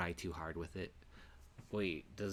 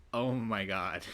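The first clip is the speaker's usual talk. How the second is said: real surprise; 10 dB louder, slower than usual. Real, not acted. Reacting to game scene.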